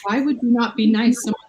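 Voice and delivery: normal talking voice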